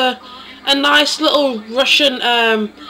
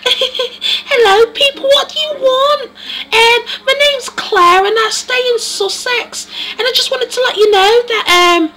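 much higher, giddy English voice with laughter; giddy Claire; 10 semitones higher, 3 dB louder than usual. Acted. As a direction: squeal it out like a giddy bride. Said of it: "really giddy type of character".

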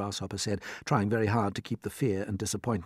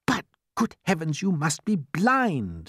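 exasperated James; 8 semitones higher, 4 dB louder, slower than usual. Acted.

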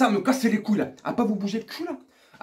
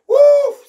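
loud, very high release of pressure; venting whoop; 13 dB louder than usual. Real, not acted. Vlogger letting off steam, loud venting cry. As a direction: let it burst out like a valve opening. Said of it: "lâcher les soupapes".

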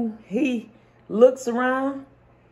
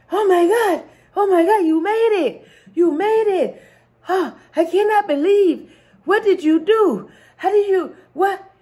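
high, loud, astonished king, laughing at the end; amazed king; 9 semitones higher, 4 dB louder than usual. Acted. King marvels at the man who swam across, high and astonished. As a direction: gush in disbelief like a stunned king.

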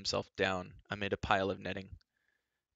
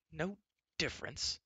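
indignant, hushed disbelief rather than a yell; angry cry; 8 semitones higher, 4 dB quieter than usual. A narrator's reading. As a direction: echo it angrily, held in. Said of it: "Terran cried angrily".

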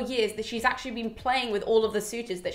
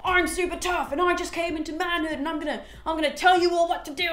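high-pitched boastful swagger; mock tough guy; 6 semitones higher than usual. Telemachus imagined bragging to the assembly, mock tough and high. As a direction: puff it up like a boy pretending he's tough. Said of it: "standing up in front of them being like".

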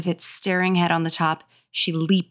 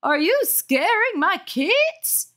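much higher, slow, accusing; shrill angry mother; 12 semitones higher, slower than usual. A narrator's reading. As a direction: drag it out like an outraged mom. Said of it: "the woman demanded".

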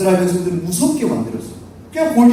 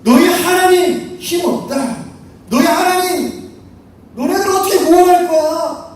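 insulting taunt; 7 semitones higher than usual. Acted. Goliath mocking Israel's God, insulting taunt. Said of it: "하나님의 이름을 모욕하는 거예요".

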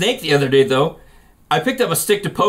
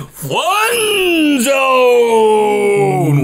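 long drawn-out, high announcer shout; booming announcer; 5 semitones higher, 4 dB louder than usual. Pretend. Host announces his segment, booming and drawn out. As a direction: stretch it out like a big show intro.

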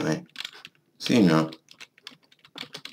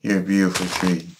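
ordinary talk

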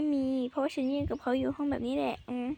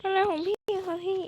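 normal talking voice

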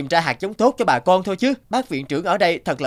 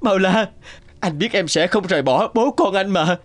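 tearful relief; 5 semitones higher, slower than usual. Acted. Husband weeps over waking wife.

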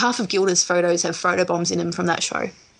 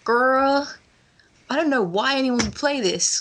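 higher-pitched mock American drawn-out "girl"; hammed-up American accent, pitched up; bad American accent; 5 semitones higher than usual. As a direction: imitate an American friend, accent badly faked. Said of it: "I can't do the American accent at all".

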